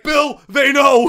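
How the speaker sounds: high, loud, stretched-out mock alarm; urgent warning. Student calling his friend the second he got out, yelling a warning. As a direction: shout the warning down the line.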